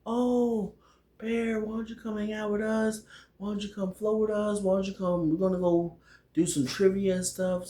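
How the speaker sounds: high, lilting coaxing voice; high-pitched imitation of a friend inviting him along; imitating Alice. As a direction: coax him in a put-on high voice. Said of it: "So Alice was like".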